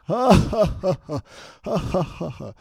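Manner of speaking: high, loud mocking "ha ha ha"; mocking laugh. Big guy laughing at the gangster's fake gun. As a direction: laugh him off, high and scornful. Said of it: "He started laughing at him".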